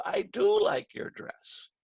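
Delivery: insistent correction leaning on "do"; slow, emphatic "do"; stress on DO. Shown as a demonstration. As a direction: draw out "do" to set the record straight.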